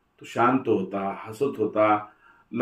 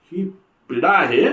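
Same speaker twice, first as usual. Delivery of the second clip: higher indignant repeat; outraged echo; 7 semitones higher, 4 dB louder than usual. A narrator's reading. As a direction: throw her word back at her. Mother-in-law's outraged echo.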